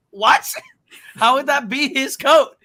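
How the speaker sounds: loud, high-pitched mock disbelief; hammed-up baffled protest; incredulous outburst. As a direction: exclaim it as if the claim is absurd. Voices viewer's disbelief at absurd claim.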